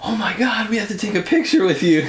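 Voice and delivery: high-pitched mock fan gushing; imitated starstruck fans. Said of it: "everybody's just like".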